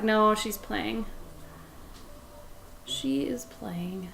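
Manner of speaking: ordinary talk, nothing marked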